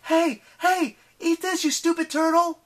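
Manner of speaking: high, loud, taunting shout at a monster; yelling. Acted. Piper taunting the giant turtle, loud high yell. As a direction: bellow the insult to draw it off.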